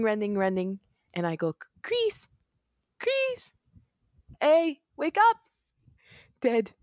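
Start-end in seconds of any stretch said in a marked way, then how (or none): 1.8-5.4 high frantic cry to wake him; frantic calling. Acted. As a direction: call out in high-pitched panic. Calling to her dead friend.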